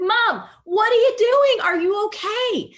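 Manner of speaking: loud, high-pitched yell up to Mom; alarmed child calling up. Retold or imitated. Child shouts up to mom on the roof, alarmed yell. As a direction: call out in worry, stretched and high.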